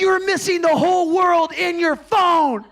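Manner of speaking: high, strained scolding yell; hammed-up outrage at a phone-absorbed niece; mad. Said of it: "Why are you getting so mad".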